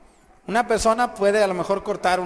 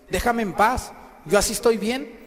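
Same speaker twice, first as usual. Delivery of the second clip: acted indignant protest, pitched up; angry protest; 6 semitones higher, 5 dB louder than usual. Possessed man tells Jesus to leave, angry protest. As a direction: push him away with a raised voice. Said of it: "Estaba enojado".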